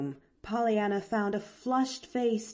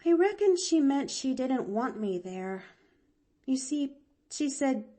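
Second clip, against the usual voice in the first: put-on higher, rueful girl voice; sighing; 6 semitones higher than usual. A narrator's reading. Girl explaining her aunt to the doctor, sighing. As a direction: explain it with a downcast air. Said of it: "Pollyanna sighed again".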